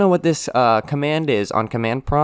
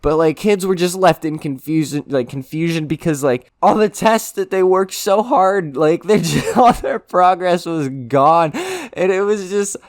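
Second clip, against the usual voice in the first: incredulous retelling; 6 semitones higher, 4 dB louder than usual. Retold or imitated. Recounting lost student progress.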